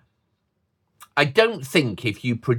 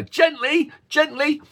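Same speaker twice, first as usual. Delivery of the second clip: parent saying gently; 4 dB louder than usual. Retold or imitated. Parent cautioning a child with a puppy or toy, high sing-song. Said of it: "toy, or whatever".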